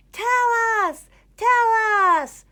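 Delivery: very high, loud chanted demand; crowd shout. A narrator's reading. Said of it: "shouted the crowd".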